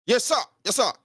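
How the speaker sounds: high, quick obedient replies; acted snappy submission; drilled subordinate. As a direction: answer orders like a recruit. Acting a drilled subordinate replying.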